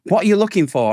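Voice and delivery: pushy salesman. Retold or imitated. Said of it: "a salesman walks up to me and says".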